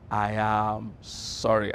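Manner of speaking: slow, drawn-out, earnest "I am sorry"; sincere apology. Shown as a demonstration. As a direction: say it slowly, meaning every word. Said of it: "One, two, go".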